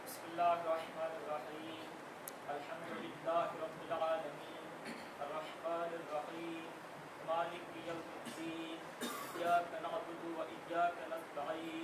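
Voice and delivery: chanted tajweed recitation; Quran recitation. Real, not acted. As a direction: recite the Fatiha in melodic chant. Student recites Fatiha.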